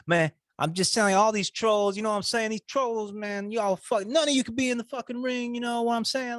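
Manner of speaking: high, hammed-up tough-guy rant; mocking a fighter. Podcaster mocking a fighter's rant at trolls, hammed-up and high. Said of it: "You know, like".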